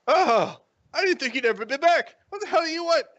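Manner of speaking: high, loud indignant voice; exasperated girlfriend. Acted. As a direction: greet an unwelcome boyfriend sharply. Acted girlfriend annoyed at visitor's return.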